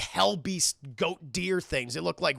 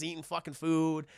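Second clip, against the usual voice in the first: normal talking voice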